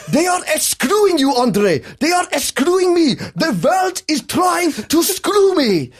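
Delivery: high, loud outraged yelling, slowing at the end; angry rant. Retold or imitated. Dad ranting to his son at the dealership, outraged shouting. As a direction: rant like the whole world is against you.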